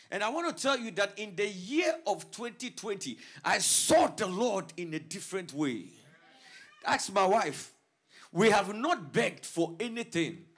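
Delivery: loud, building preacher climax; preaching at full force. Real, not acted. Pastor proclaiming God's faithfulness, loud climactic preaching. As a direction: lift the church with a loud proclamation.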